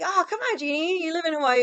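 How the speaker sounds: high, excited sister's voice; mimicking little sister. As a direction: squeals like an exasperated younger sister. Sister mocked in Pidgin.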